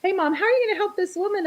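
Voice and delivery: high, quick imitation of a daughter. Daughter asking her mom about helping a woman, high-pitched imitation. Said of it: "my daughter's like".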